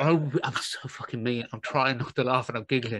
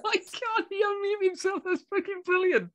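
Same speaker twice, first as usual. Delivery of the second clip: much higher, laughing exclamation; laughing delight. Real, not acted. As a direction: crack up at the surprise. Laughing at the unmuted sergeant.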